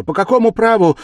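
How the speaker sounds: very high, loud challenge; offended outcry; indignant. A narrator's reading. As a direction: demand it with outrage. Offended girl protests.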